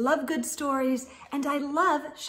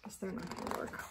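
quick hushed aside; rushed whispered aside; fast whisper; 5 semitones lower, 18 dB quieter, faster than usual. Real, not acted.

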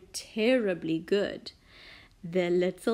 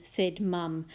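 usual speaking voice

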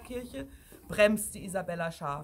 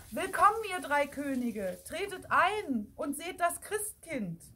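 energetic call; 6 semitones higher than usual. A narrator's reading. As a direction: summon them in firmly. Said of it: "hörte man erneut und energischer".

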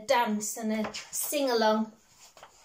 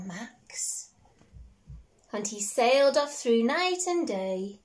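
storybook reading; 3 dB louder than usual. Acted. Parent reading a picture book to a child, gently lilting. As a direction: read it like a bedtime story.

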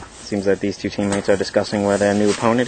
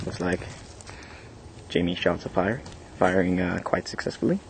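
hushed; 2 semitones lower, 9 dB quieter than usual. Real, not acted. Hushed commentary while hiding.

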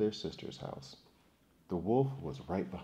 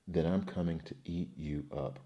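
usual speaking voice

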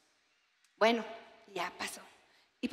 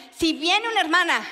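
ordinary delivery

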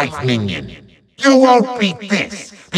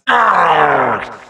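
drawn-out falling roar of frustration; villain's exasperated "arrgh"; frustrated growl; 4 semitones lower than usual. Acted. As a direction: growl out your fury. Villain rages at failure.

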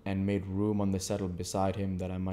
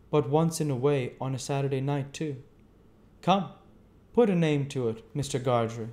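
higher, louder stranger's voice; 6 semitones higher, 4 dB louder than usual. A narrator's reading. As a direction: voice the odd stranger, curious. Stranger insists on a drink.

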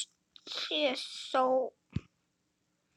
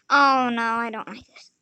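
ordinary delivery

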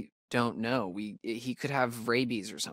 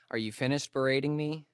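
usual speaking voice

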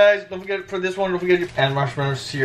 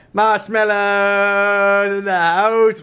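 hyped announcer; 5 semitones higher, much slower than usual. Pretend. Announcing marshmallow taste.

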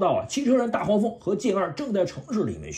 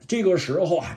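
ordinary delivery

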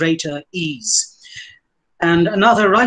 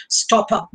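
"Stop up" with "Stop" high and firm, "up" falling; stress on STOP; 4 semitones higher than usual. Shown as a demonstration. Lecturer showing a trochee, "Stop" stressed over "up". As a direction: punch "Stop", let "up" drop. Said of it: "The emphasis on stop".

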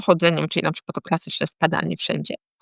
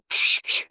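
imitated radio static; 5 dB quieter than usual. Shown as a demonstration. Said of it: "Twoja głowa słyszy takie".

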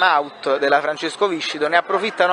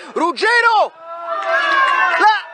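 excited goal shout; 13 semitones higher, slower than usual. Real, not acted. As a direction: burst out calling the scorer. Goal scored, excited call.

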